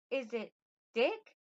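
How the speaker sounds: put-on high young lady's guess; young queen, feminine. Queen tries another name, feminine questioning voice. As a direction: ask tentatively, pitch climbing high. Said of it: "soft-spoken and".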